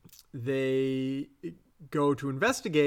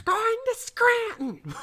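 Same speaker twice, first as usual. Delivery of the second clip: high slow shrieking yell; Edith Bunker yell; slower than usual. Retold or imitated.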